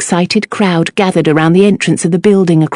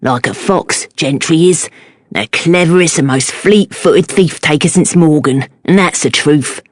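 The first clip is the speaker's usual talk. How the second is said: lowered admiring Cockney voice; Cockney accent. A narrator's reading. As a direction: praise him like a proud Cockney. Cockney fishmonger admires Gentry.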